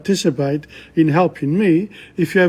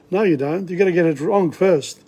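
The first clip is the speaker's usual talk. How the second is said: ordinary delivery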